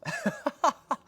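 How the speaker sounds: high, breaking up mid-story; laughing fit. Real, not acted. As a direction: burst out laughing at your own story.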